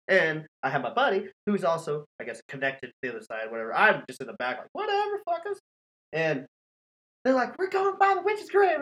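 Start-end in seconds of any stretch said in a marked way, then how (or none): 4.7-5.6 high-pitched, dismissive retort; imitated brush-off, pitched way up; mock dismissal. Acts out dismissing friends in car. 7.6-8.8 high mock-spooked friend voice; high, teasing impersonation; mocking imitation. Mimics excited friends as he scoffs.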